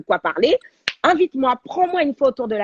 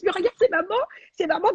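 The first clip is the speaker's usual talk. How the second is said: high, thrilled call to her son; excited calling out; 10 semitones higher than usual. Retold or imitated. To her son in front of the TV, calling out excitedly. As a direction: point at the screen, thrilled. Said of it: "je dis à mon fils".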